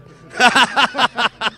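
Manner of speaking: a loud, high burst of laughter; hearty laugh. Real, not acted. Mayor laughing at his sword-fighting joke, loud laughter.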